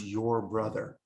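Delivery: "your brother" said slowly, leaning on both words; stress on your brother. Shown as a demonstration. Joseph calming his fearful brothers, stressing "your brother". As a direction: lean on "your brother" to calm them. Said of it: "he stressed the words".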